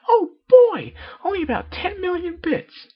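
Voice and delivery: very high, loud squeal of surprise; squealing pony shocked by the cost. Acted. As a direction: shriek at the price. Pinkie shocked at price.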